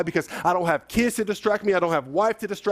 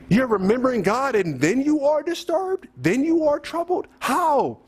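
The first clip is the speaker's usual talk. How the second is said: high-pitched, baffled questioning; incredulous; 6 semitones higher than usual. Acted. Preacher reacting to a puzzling psalm verse, incredulous. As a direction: question it in disbelief, pitch climbing.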